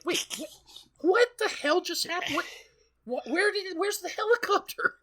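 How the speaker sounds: high, rising panicked confusion; confused outcry. Retold or imitated. Character baffled that the helicopter vanished, high and frantic. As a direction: yelp it like you're totally lost.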